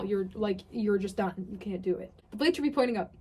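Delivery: usual speaking voice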